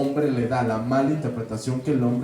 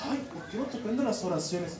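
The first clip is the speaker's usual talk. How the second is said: high, soft mock complaint; imitated nagging, pitched up and softer; fretting catechist; 6 semitones higher, 10 dB quieter than usual. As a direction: whine it like an exasperated catechist. Voices a fretting catechist scolding.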